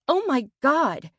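high, loud gasp of horror; shocked. A narrator's reading. Narrator finds a hole in friend's chest, shocked. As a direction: exclaim in shock, pitched high.

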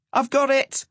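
high-pitched triumphant call; excited cry. A narrator's reading. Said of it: "Rise cried".

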